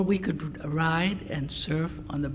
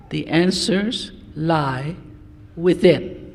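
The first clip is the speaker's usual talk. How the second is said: slow, firm and louder, utterly certain; decided voice; 7 dB louder, slower than usual. Acted. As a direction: lay down the answer like a final truth. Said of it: "in a very decided voice".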